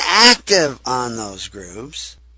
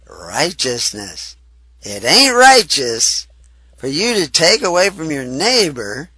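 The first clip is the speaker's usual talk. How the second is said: ordinary talk, nothing marked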